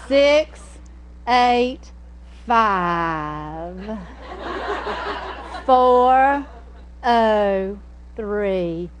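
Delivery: imitating mother's very slow Southern phone number; hammed-up Southern drawl, digit by digit; exaggerated Southern drawl. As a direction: stretch each digit, five as two syllables. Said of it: "two syllables in five".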